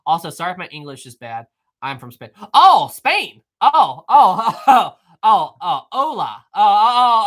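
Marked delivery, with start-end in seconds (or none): none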